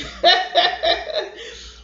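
loud, high chuckling laugh; hearty acted laugh. Grandfather amused at his own mistake, laughing.

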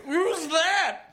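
high-pitched cartoon yelp; hammed-up frightened question; alarmed Cosby. A narrator's reading. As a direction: call out in squeaky mock alarm.

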